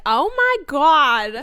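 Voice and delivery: loud, drawn-out New Jersey accent. Shown as a demonstration. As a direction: stretch it out in brash New Jersey style. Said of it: "I'll do it like that".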